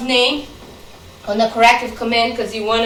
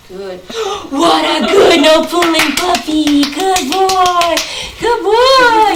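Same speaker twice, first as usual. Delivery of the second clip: high, loud gushing praise; squealing delight at a good dog; puppy praise voice; 8 semitones higher, 7 dB louder, much slower than usual. Real, not acted.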